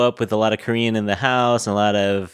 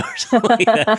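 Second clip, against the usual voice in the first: laughing; 12 semitones higher, 4 dB louder, faster than usual. Real, not acted. Laughing at own question.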